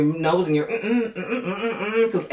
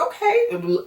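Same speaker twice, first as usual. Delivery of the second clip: a much higher, delighted "Okay"; pleased surprise. Real, not acted. Reacting to the sweet breakfast scene with a delighted high "Okay". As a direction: coo it like something sweet just happened.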